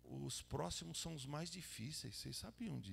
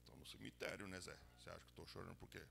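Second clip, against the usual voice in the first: mourner's reply; 9 semitones lower, 8 dB quieter, faster than usual. Retold or imitated.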